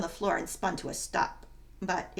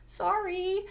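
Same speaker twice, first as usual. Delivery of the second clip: sheepish sorry; 11 semitones higher than usual. Acted. Clumsy Janet apologizing after crash.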